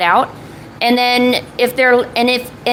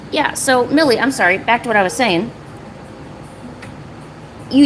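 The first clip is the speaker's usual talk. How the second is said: ordinary talk, nothing marked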